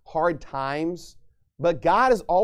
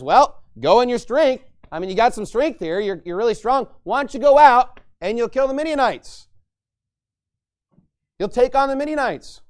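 urging on; 7 semitones higher, 5 dB louder than usual. Acted. The Lord urges Gideon on.

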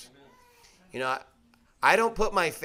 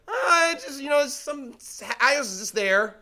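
evasive liar; 6 semitones higher than usual. Acted. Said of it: "when you're lying".

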